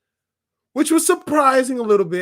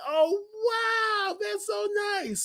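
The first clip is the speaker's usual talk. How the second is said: very high, soft squeal of wonder; airy falsetto amazement; delighted awe; 14 semitones higher, 4 dB quieter, much slower than usual. Retold or imitated. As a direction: coo it way up high. Reacting to surprise appearance.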